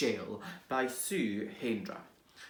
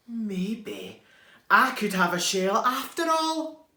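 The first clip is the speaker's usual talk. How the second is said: hopeful idea; 7 semitones higher, 6 dB louder than usual. Acted. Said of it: "he thought".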